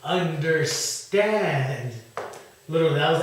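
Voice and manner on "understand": very slow, drawn-out words; talking slowly. Acted. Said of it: "I talk slowly so they understand".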